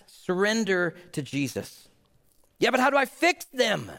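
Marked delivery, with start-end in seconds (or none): 2.6-3.9 whiny objection. Retold or imitated. Spouse asking to fix partner.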